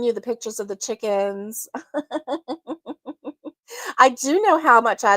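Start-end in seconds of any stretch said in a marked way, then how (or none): 1.7-3.5 soft laugh. Real, not acted.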